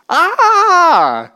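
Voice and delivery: sudden high, loud scream of terror; terrified cry. Retold or imitated. Trembling people at Sinai cry out in terror, high and loud. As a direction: cry out like someone who thinks they're about to die. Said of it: "Their response is".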